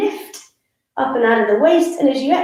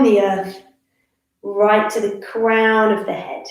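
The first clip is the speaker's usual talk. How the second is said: guided meditation. Real, not acted. Yoga teacher settles the class into their feet, guided meditation.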